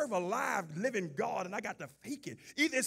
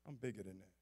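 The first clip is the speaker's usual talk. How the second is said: mild mock dad; 13 semitones lower, 16 dB quieter, much faster than usual. Forced-smile father voiced low and soft.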